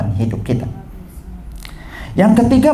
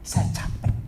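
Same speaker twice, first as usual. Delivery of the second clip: soft, spent confession; hushed acted weariness; exhausted mother; 11 dB quieter than usual. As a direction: let it fall away, all strength gone. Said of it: "Dia bilang begitu".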